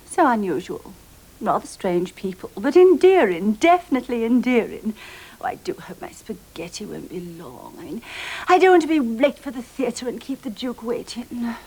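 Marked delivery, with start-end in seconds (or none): 5.4-8.0 soft, low, breathy aside; private murmur. Acted. Diner waiting alone for food, soft low murmur. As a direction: fret quietly to yourself.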